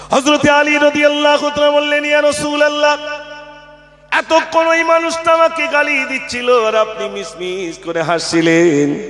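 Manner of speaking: impassioned waz narration; full-force preaching. Acted. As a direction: preach the tale in a soaring chant.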